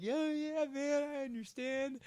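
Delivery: put-on high, slow, spacey drunk kid. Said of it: "the kid's like".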